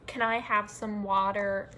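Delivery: WATER said the American way, R sounded at the end; American accent. Shown as a demonstration. Showing how they never speak, American-accented request for WATER. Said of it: "I don't speak like that".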